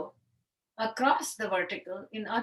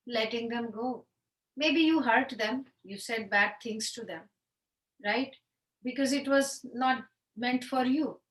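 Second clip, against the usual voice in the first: normal talking voice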